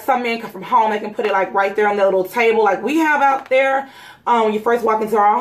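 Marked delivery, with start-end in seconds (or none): none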